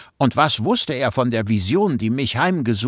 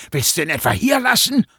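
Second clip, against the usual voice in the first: higher and fast, snapped at his friend; snapping; 6 semitones higher, faster than usual. Acted. Dante snaps at his buddy, angry and fast. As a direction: spit the question back at him. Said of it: "fauchte mein Kumpel mich an".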